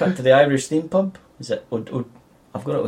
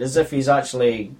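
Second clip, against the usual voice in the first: ordinary delivery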